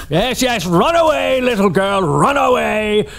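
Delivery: high, laughing taunt; mocking laughter. Employer jeering at his fleeing assistant, mocking laughter. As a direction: taunt her through giggles as she runs. [name] mocks fleeing Casey.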